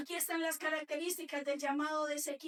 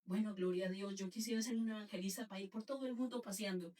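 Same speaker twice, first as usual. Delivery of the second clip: lower, soft, dreamy voice of her younger self; dreamy naive wish; 10 semitones lower, 5 dB quieter than usual. Retold or imitated. Preacher recalling her naive wish to be an evangelist, dreamy and lower. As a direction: sigh it out like a girl daydreaming of travel.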